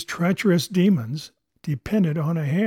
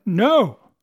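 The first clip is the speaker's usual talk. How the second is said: cried no; 4 semitones higher, 6 dB louder than usual. A narrator's reading.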